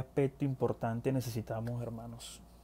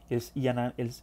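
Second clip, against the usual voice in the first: usual speaking voice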